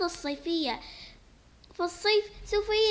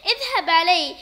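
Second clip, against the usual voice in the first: laughing yell; 8 dB louder than usual. Acted. Child yelling through laughter.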